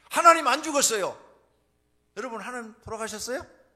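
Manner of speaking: loud, high declaration; forceful proclamation. Real, not acted. Preacher telling congregation God is not dead, forceful proclamation.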